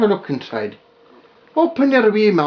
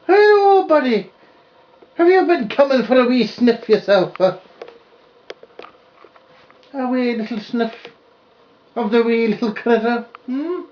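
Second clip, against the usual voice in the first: put-on high baby talk; 6 semitones higher than usual. Greets dog in high voice.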